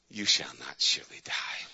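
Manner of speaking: hiss-free low lie of the snake; sly snake voice. Acted. Snake lying to Eve, low and sly. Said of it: "that rotten, stinking snake".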